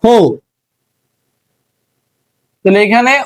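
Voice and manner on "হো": হো said on its own with a rounded o, like ho in home; sound demo. Shown as a demonstration. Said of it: "হো শব্দটা ব্যবহৃত হচ্ছে".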